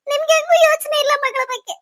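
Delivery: high, crying reproach; tearful protest. Acted. Beaten wife reproaching her husband, tearful protest. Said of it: "ಯಾಕ್ ನೀವ್ ಅಳ್ತಾ ಇದ್ದೀರಾ".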